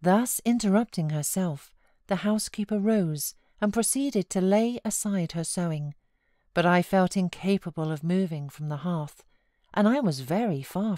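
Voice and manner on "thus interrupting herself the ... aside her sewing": plain reading voice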